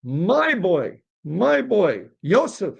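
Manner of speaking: high-pitched wailing oy; lamenting father.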